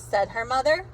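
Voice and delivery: ordinary delivery, nothing marked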